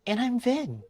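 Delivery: very high character voice; Vin. Acted. Young character meeting an old-timer, high put-on voice. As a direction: introduce yourself in a small, high voice.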